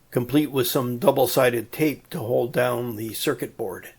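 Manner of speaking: usual speaking voice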